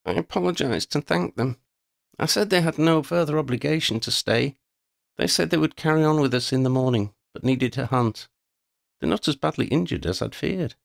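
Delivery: put-on a young leader relieved but subdued; Lydia, calm leader. A narrator's reading.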